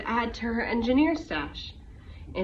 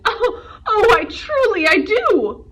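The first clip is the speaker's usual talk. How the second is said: high, loud, laughing through tears; laughing hard; 10 semitones higher, 9 dB louder than usual. Acted. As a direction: laugh till the words nearly break. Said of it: "Fred hooted".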